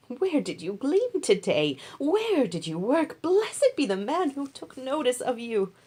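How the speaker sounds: a curious mother-in-law leaning in with questions; Naomi, eager mother-in-law. Acted. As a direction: Naomi pressing her daughter-in-law.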